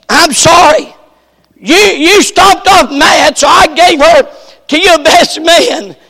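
a hint of a sheepish father explaining; father excusing himself. Father voiced in retelling.

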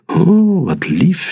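high swooping "oh" of endearment; touched, sing-song storybook mum; cooing delight. A narrator's reading. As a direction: coo it, starting very high and settling. Mama coos over love letter.

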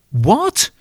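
high, sharp what; feigned deafness, blurted high; stalling friend shouting back; mock loud what. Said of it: "rather loudly".

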